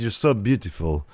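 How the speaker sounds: a hint of Arnold's Austrian accent, wooing; Arnold impression. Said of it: "Why are you talking like Arnold?".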